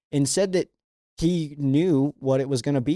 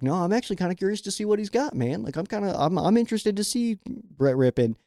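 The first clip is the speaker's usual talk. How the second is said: mocking other fans; 6 semitones higher than usual. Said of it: "so many people had said".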